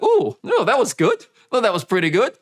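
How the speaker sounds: high, bright, delighted gasp; imagined speaker impressed by their own voice; pleasantly surprised. Retold or imitated. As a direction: surprise yourself with a good line. Viewer pleasantly surprised at themselves.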